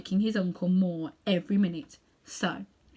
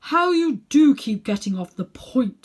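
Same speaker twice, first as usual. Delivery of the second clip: impatient, higher, slow scolding; testy old man, louder; irritated uncle; 6 semitones higher, 11 dB louder, slower than usual. Acted. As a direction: wave away the boy's objection. Uncle Andrew brushes off Diggory.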